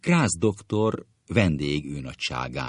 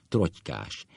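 usual speaking voice

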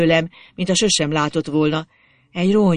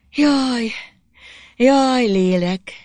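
high, drawn-out mock lament; smiling teasing; 8 semitones higher, 3 dB louder, much slower than usual. A narrator's reading. Girl at his reluctance to drink, smiling teasing. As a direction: tease with a smile in the voice.

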